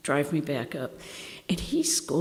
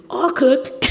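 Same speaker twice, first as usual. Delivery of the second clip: eager offer; 7 semitones higher, 8 dB louder than usual. Retold or imitated. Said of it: "And I went".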